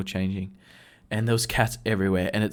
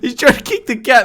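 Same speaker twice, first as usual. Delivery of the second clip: ordinary talk, nothing marked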